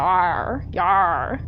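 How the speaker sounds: mock pirate arr; a quick jokey pirate call; pirate growl. Joking she's a pirate now, light pirate growl. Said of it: "we're a pirate now".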